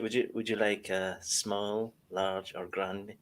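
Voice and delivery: soft, slow barista patter; a hushed, drawn-out imitation of a barista rattling off sizes; mock barista. Mocking the barista's size question, softly and slowly. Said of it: "It's like".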